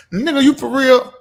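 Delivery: high, loud disbelieving yell; incredulous shout. Retold or imitated. Vlogger imitating friends shocked she won't attend, loud high disbelief. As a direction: throw the friends' disbelief back loudly.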